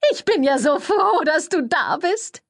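sugary high-voiced schmoozer; gushing publicist. A narrator's reading. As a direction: coo it like an overeager press lady. Cordelia gushing at Thursday.

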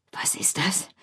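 an anxious breathy whisper; fearful whisper. A narrator's reading. The rat whispers fearfully after hearing scratching in the tunnel. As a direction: whisper it, scared of the noise. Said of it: "flüsterte die Ratte ängstlich".

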